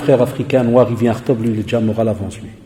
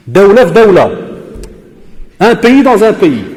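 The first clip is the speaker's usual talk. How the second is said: loud, higher sarcastic cry; mocking exclamation; 6 semitones higher, 5 dB louder, slower than usual. Real, not acted. Teacher loudly mocking a pupil's claim about the Rif. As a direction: throw up your hands in disbelief.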